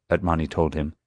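ordinary delivery, nothing marked

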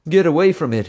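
high, loud warning yell. A narrator's reading. Bubba warning the others about the explosive, loud high yell. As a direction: shout at them to get back. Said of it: "he yelled".

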